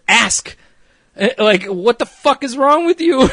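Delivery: loud, high exasperated telling-off; retold angry yell, letting someone have it; yelling at her. Boss ripping into worker Shannon, exasperated yelling. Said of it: "Kristen rightfully yells at her".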